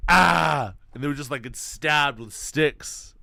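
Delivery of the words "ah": loud, higher caveman war cry; mock battle yell. Hunters charging the tired zebra with a loud war cry. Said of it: "all they would hear would be this".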